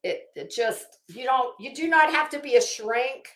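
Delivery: ordinary talk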